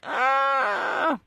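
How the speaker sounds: high acted scream of terror; drawn-out high pretend shriek; mock scream. Voices family lying on the ground.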